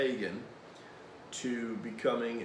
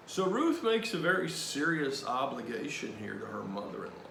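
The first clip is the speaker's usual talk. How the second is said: usual speaking voice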